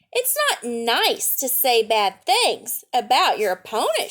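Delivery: bright read-aloud storytelling voice; lively picture-book reading; story narration. A narrator's reading.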